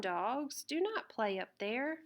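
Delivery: ordinary delivery, nothing marked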